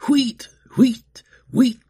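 high bleating whistle blasts; whistle imitation. A narrator's reading. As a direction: voice three shrill whistle toots. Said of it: "bleated on her whistle".

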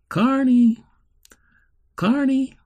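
high, drawn call of a name. A narrator's reading. Man calling the girl lost in her mind, high searching call. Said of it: "she still heard him calling".

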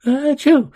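high, loud acted "achoo"; sneeze. A narrator's reading. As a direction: burst out a sudden sneeze. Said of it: "sewer rat number one sneezed".

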